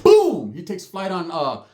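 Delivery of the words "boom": high, loud mouth boom; punch sound effect. Retold or imitated. Describing the punch landing, loud high boom. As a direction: smack out the impact.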